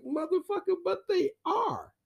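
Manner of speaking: high, outraged woman's voice; imitating bestie. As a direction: squeal it back at him. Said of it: "she's like".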